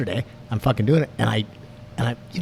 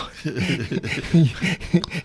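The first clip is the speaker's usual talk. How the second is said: strained laugh breaking the threat; laugh mid threat; 4 semitones higher than usual. Retold or imitated. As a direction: laugh through the mock threat.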